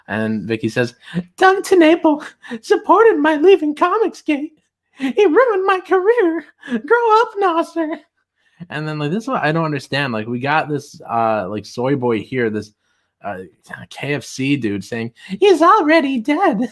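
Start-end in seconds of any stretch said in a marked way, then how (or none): 1.4-8.0 very high, slow, loud mocking falsetto; slow high-pitched parody of an online critic; mocking tweet voice. 15.4-16.6 high, loud, drawn-out falsetto; exaggerated high-pitched parody; mocking commenter voice. Mocks a commenter's tweet.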